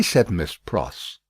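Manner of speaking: plain reading voice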